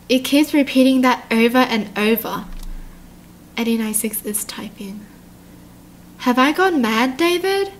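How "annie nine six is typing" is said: soft breathy unvoiced whisper. Shown as a demonstration. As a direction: hush it like a secret in the dark. Whispers eerie typing notice.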